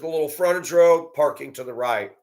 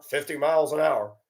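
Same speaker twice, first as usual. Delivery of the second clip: ordinary delivery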